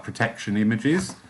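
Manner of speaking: ordinary delivery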